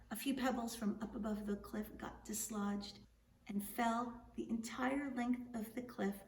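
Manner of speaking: ordinary delivery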